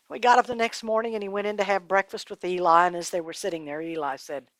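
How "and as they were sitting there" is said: ordinary delivery, nothing marked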